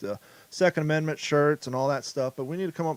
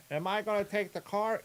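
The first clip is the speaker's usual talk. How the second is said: higher, soft, unsure sidekick; high timid sidekick voice; 5 semitones higher, 7 dB quieter than usual. Pretend. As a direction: ask it meekly like a nervous henchman. Sidekick asks in skit.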